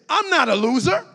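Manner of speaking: loud declaration of I'm not a loser; declared aloud. Shown as a demonstration. Preacher modelling the declaration for the church, loud. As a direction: proclaim it to the room. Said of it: "So say this".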